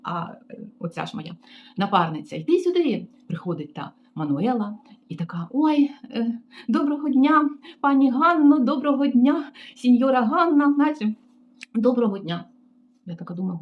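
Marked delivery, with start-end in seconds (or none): 2.3-3.1 higher bossy call; mock summons. Receptionist summoning the colleague, mock bossy call. 5.5-12.5 higher, sugary obsequious voice; butter up the boss; fawning greeting. Retold or imitated. Colleague fawning over the receptionist, sugary mock greeting.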